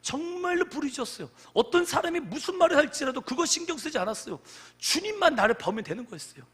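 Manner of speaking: high, loud, fervent sermon voice; forceful preaching. Real, not acted. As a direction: drive the story home with force.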